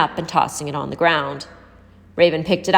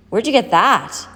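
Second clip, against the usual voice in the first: demanding; 5 semitones higher, 3 dB louder, slower than usual. A narrator's reading. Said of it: "the girl demanded".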